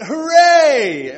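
very loud, high celebratory hooray; long, whooping hooray; big cheer. Shown as a demonstration. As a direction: belt out an over-the-top cheer. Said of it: "it wasn't".

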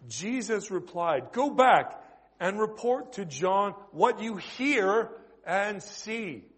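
slow, declamatory pulpit reading; scripture reading. Real, not acted. Reads the passage again and onward.

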